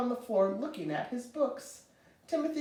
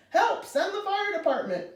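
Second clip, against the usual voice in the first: high, urgent cry for help; excited shouting; 11 semitones higher, 3 dB louder than usual. A narrator's reading. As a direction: shout frantically down the telephone. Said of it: "hear him shouting into the, into it excitedly".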